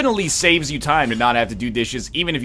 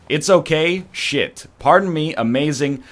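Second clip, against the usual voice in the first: ordinary talk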